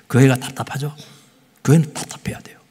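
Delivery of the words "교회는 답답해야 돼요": hushed emphatic whisper. Real, not acted. Pastor telling the congregation the point, whispered.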